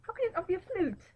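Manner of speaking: high-pitched mock English accent; put-on English accent. Player disputing what a kazoo is, high English accent.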